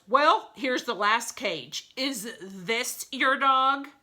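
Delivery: patient guard asks; lightly voiced gorilla.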